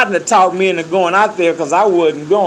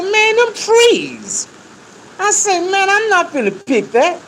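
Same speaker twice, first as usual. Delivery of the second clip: disbelief; 13 semitones higher than usual. Retold or imitated. Young worker sees cotton plants as tall as trees, stunned. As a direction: sound like you can't believe your eyes.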